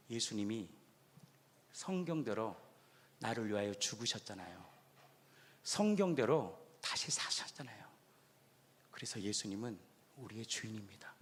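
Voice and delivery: soft, low, half-whispered answer; hushed confession. Acted. Pastor softly voices the believer's answer. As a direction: barely breathe the confession. Answering who is your saviour.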